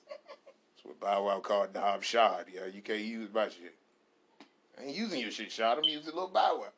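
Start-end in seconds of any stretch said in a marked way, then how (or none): none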